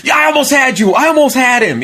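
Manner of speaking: high laughing shout; Tommy cracking up. Retold or imitated. Tommy gloating to the table that he almost had Henry, shouting high. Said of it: "And Tommy goes".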